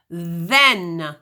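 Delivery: "then" with a buzzing, voiced th. Shown as a demonstration. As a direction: make the th in "then" vibrate. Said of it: "But if you say the word then".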